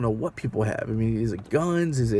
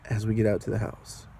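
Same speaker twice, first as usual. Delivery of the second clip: normal talking voice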